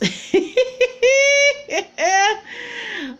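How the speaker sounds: high squealing burst of laughter; real high laugh. Real, not acted. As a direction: cackle up high at the joke. Laughing at her own voice.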